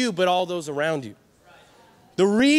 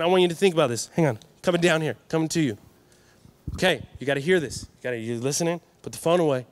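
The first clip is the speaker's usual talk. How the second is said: usual speaking voice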